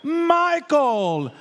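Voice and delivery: long, drawn-out, shouted fighter name; lucha libre announcer. Real, not acted. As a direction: belt the name out to the crowd. Ring announcer calling a fighter's name.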